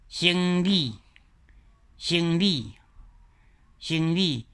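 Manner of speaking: 生理 said three times, each pronounced carefully; repeated example word. Shown as a demonstration. Teacher repeating example word for the class. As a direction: drill 生理 slowly. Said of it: "比如讲".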